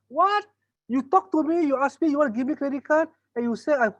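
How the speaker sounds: offended customer snapping back; indignant outrage. Retold or imitated. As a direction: customer rejected after being courted, outraged.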